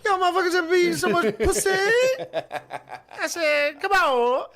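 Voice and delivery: giggling falsetto joke voice; laughing falsetto. Pretend. Joking falsetto bit, laughing.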